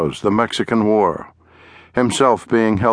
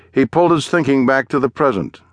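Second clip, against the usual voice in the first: usual speaking voice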